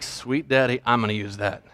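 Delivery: low, hushed aside to himself; mock inner voice, secretly pleased; delighted private thought. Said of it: "I'm like".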